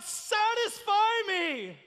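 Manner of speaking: very high, drawn-out cry; shouted demand. Acted. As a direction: shout it slowly to the skies. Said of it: "we've shouted out".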